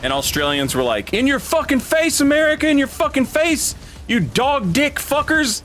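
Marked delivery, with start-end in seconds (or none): 1.1-5.6 high-pitched, hammed-up gloating at a rival; mock taunting. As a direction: crow insults in a much higher voice, imitating rowdy fans. Mimics Australians gloating over Olympics.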